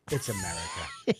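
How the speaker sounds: ordinary delivery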